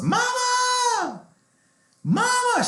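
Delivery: shrieking Mama way up high and loud; retold panicked scream, pitched very high; frantic shout for mom. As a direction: yell for your mother across the house in a panic. Sister calls her mom after shock.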